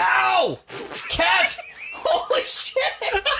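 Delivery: real high yelp of pain; startled pain yell. Real, not acted. Man clawed by the cat, startled pain yell. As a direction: cry out sharply, breaking into laughter. Cat claws the speaker mid-talk.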